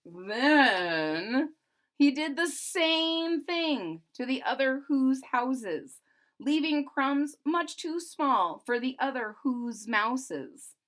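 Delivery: put-on rhythmic read-aloud to children; storybook narration. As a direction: lilt the rhyming verse. Reads the Grinch book aloud.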